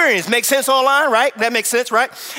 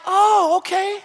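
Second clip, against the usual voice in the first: higher, sheepish backpedal; sheepish relief; 10 semitones higher than usual. Retold or imitated. Husband realizes waiter says it to everyone, sheepish high voice. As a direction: pretend it was fine all along.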